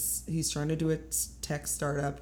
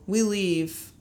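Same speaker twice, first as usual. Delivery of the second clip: ordinary talk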